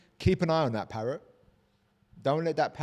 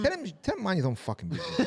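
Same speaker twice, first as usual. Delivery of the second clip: higher, fast and worked up; indignant outburst; 9 semitones higher, faster than usual. Real, not acted. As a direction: fire it off in disbelief. Outraged advice.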